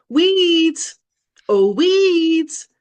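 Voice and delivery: high, loud, drawn-out jeer; taunting playground call. Retold or imitated. Coach acting out kids taunting her across the playground, high jeering call. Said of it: "hearing this from across the playground".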